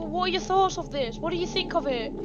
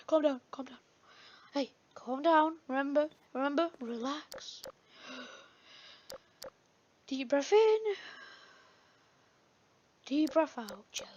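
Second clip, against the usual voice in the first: soothing; 2 semitones lower, 10 dB quieter than usual. Pretend. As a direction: talk them down gently, breath by breath. Soothing angry companion, breathing exercise.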